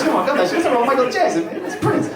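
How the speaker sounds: high-pitched mock exasperation; exasperated correction. Friend correcting the wrong singer, high and exasperated. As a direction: protest in a high, worked-up voice.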